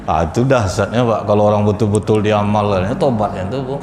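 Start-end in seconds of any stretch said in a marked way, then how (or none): none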